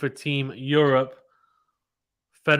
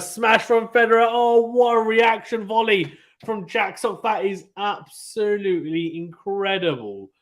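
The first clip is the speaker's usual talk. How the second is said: loud, high-pitched amazed play-by-play; excited commentary; 6 semitones higher, 3 dB louder than usual. Real, not acted. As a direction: shout the rally home, then stretch the words in awe. Live call of an amazing volley.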